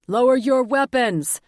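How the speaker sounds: loud, high command through a megaphone; megaphone holler. Acted. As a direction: blast it from the helicopter. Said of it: "hollered through a megaphone".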